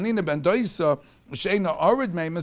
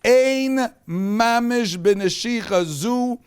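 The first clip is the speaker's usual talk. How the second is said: louder, slow and emphatic Torah preaching; preaching at full force; 4 semitones higher, 6 dB louder than usual. Acted. Teacher rousing his class at the lesson's climax, louder fervent preaching. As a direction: drive the lesson home with fervour.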